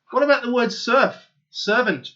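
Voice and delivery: ordinary talk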